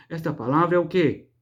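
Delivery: ordinary talk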